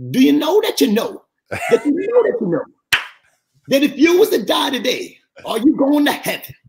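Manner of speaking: high-pitched Southern preacher voice; Southern pastor. Retold or imitated. Pastor asking his congregation about salvation, Southern voice. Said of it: "He said in his Southern voice".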